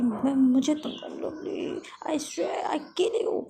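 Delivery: hammed-up outrage; exasperated threat. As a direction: build to a strained, dragged-out threat. Exasperated threat in acted quarrel.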